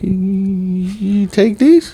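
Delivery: high, very slow, doddering question; innocent old man with coupons. Pretend. Old man at the register asks about his coupons, high and slow. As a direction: play dumb like a confused grandpa. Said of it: "be like".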